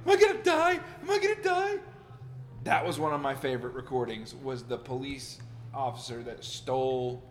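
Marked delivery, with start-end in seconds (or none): 0.0-1.8 very high, frantic mock panic; panicked. The producer high on edibles, panicking in mock terror. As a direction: squeal it like he's losing his mind. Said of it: "just out of his fucking mind".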